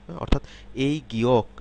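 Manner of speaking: ordinary delivery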